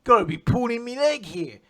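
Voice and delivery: loud mock Cockney, slowed at the end; Wally's Cockney voice. Retelling Wally's shock at the tanks, loud mock Cockney. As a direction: bellow it like a cheeky London lad.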